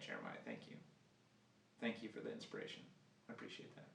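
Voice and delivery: whispered sarcastic thanks; muttered aside. Real, not acted. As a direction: mumble it off to the side.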